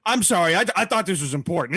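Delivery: usual speaking voice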